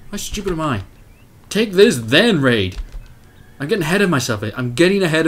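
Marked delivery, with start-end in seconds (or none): none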